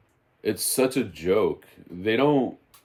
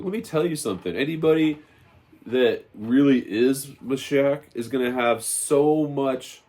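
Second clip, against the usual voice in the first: raised, insistent sermon voice; fiery preaching; 5 semitones higher than usual. Acted. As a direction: preach it to the congregation with fire. Preaching resumes after story.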